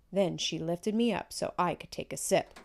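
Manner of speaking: ordinary delivery, nothing marked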